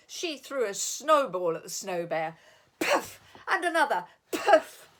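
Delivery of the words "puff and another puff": loud puff sounds. Acted. Snowballs thrown.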